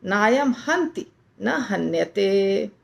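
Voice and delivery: नायम हंति न हन्यते recited slowly; slow Sanskrit recitation; slow model recitation. Shown as a demonstration. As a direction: recite the line slowly and evenly. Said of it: "ऐसे".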